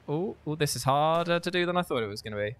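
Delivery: usual speaking voice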